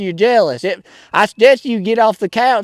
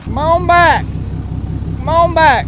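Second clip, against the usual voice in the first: calling out directions; 7 semitones higher, slower than usual. Acted. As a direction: holler to a driver backing up. Guiding someone backing up a truck.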